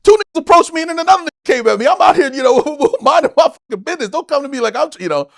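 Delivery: high, loud riled-up rant. Retold or imitated. Comic ranting at Aries Spears, high loud riled-up rant. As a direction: vent fast and heated. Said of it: "But I was like".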